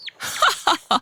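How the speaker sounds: high gleeful cackle; the fox bursts out laughing at his trick; fox's triumphant laugh; villain's burst of laughter. Acted. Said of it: "Le renard éclate de rire".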